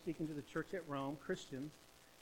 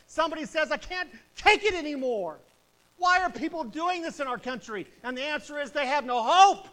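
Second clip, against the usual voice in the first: loud, higher, urgent sermon climax; full-force preaching; 5 semitones higher, 12 dB louder than usual. Acted. Preacher pleading with Baptists to care, at full force. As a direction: shout the warning at the pews.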